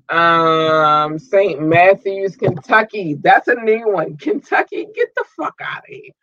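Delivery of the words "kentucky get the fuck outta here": delighted disbelief. Real, not acted. Host amazed at new listeners in Kentucky, high squealed disbelief.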